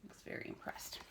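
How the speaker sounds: whispered, dry; whispered wry aside. Real, not acted. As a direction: mutter it sarcastically.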